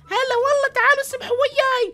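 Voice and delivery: sing-song high villain invite; high, loud mocking policewoman. Player voicing the policewoman inviting the prisoners to swim with her, high and loud. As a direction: lure them in from way up high.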